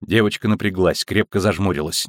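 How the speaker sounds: plain reading voice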